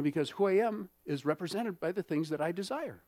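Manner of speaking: ordinary delivery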